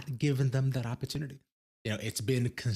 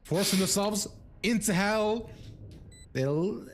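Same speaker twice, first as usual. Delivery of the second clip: rising hype, voice climbing higher; esports caster; 10 dB louder than usual. Real, not acted. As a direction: build to a screaming climax call. Caster hype on clutch play.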